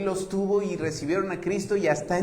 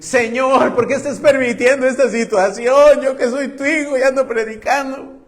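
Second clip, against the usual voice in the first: Paul's complaint; 6 semitones higher, 7 dB louder than usual. Acted. Preacher voices Paul's complaint.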